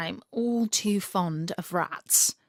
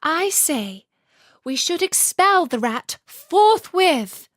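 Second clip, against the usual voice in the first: much higher, louder, slow pompous swan; haughty swan; 8 semitones higher, 7 dB louder, slower than usual. Acted. Swan Sadie loudly demanding the rat be expelled. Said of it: "said Sadie".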